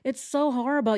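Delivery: hammed-up fake sorrow; mock sympathy. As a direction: sneer at fake pity. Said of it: "what she did".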